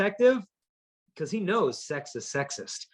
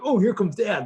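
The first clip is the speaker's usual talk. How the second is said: a hint of a small boy, a bit higher and louder; lightly voiced little kid; 5 semitones higher, 5 dB louder than usual. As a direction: play the caught kid lightly. Kid caught eating ice cream.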